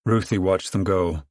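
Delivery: usual speaking voice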